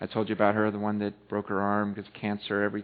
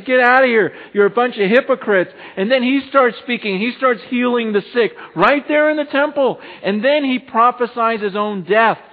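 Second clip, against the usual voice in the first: high, loud, impassioned sermon; ringing proclamation to the congregation; full-force preaching; 11 semitones higher, 10 dB louder than usual. Real, not acted.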